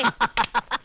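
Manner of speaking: short laugh without words. Acted. Husband caught out by his wife, laughing. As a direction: laugh off the remark.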